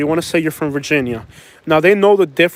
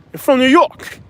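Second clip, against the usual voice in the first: high, hammed-up New York accent; mock New York accent; 9 semitones higher, 3 dB louder than usual. Mimicking the accent that gives you up, high mock New York accent. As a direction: put on an exaggerated New York "Yawk". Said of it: "your accent's gonna give you up".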